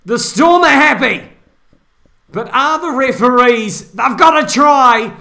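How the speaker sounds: loud, high rugby commentary at full pitch; excited play-by-play. Real, not acted. Commentator calling a possible try as it goes to video, excited play-by-play. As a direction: call the big moment over the crowd, stretching key words.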